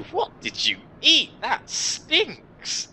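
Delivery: high-pitched disgusted complaint; disgusted outcry. Acted. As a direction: pitch leaping up in revulsion. Character complains about a stench.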